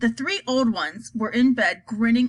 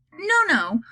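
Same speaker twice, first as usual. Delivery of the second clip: mild yell, pitched up; raised, higher-pitched refusal; yelled back; 6 semitones higher than usual. A narrator's reading. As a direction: call back over the noise, lightly. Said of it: "Mister Wonka yelled back".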